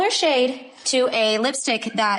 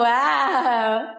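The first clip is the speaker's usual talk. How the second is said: ordinary talk, nothing marked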